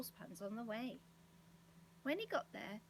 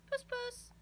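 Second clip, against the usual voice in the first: high-pitched cat call; 14 semitones higher, 3 dB louder than usual. A narrator's reading. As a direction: call puss in a high little voice. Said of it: "he called".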